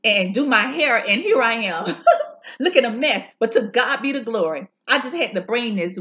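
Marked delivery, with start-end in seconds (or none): 1.9-2.5 laugh. Real, not acted. Laughing at her messy look.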